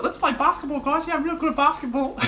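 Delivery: very high, excited mate's impression; high-pitched goofy mate voice; the Blythe voice. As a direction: squeak it out like a clueless, delighted bloke. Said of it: "The Blythe voice".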